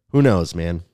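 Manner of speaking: ordinary delivery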